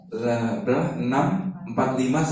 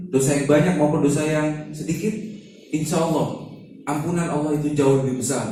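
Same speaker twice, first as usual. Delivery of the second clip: ordinary talk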